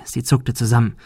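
usual speaking voice

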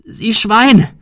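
high, loud furious roar; enraged yell. A narrator's reading. Shirley faces the hoarse-laughing attacker, yelling in rage. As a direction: hurl the insult at full voice. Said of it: "brüllte sie".

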